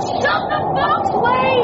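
high, slow shout over a gale; drawn-out high yell to be heard; urgent yell. Acted. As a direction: order him through the roaring wind. Yelling over a roaring wind.

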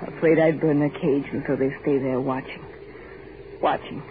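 low, quiet, defeated; hushed despair. Acted. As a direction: let it sink, quiet and low.

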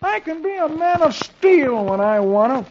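high, slow, self-important husband; proud boasting. A narrator's reading. Dagwood to himself at the window, proud drawn-out boasting. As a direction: savour each boast, stretching the big words. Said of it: "says to himself proudly".

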